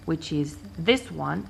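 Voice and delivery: ordinary delivery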